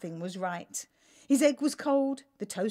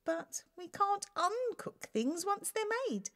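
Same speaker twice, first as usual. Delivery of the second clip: timid; 11 semitones higher than usual. Acted. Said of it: "she stuttered".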